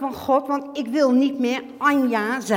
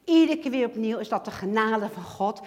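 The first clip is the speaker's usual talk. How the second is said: usual speaking voice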